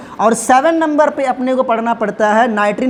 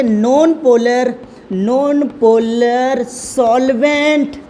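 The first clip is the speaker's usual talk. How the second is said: very slow, stretched-out words; drawn-out board-writing voice, dropping at the end; dictating while writing; much slower than usual. Real, not acted. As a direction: dictate word by word, pausing as you write. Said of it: "तो यहाँ पर लिखेंगे".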